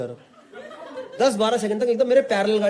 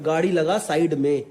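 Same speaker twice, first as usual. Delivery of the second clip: plain Hindi; 7 semitones lower, slower than usual. Shown as a demonstration. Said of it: "मतलब".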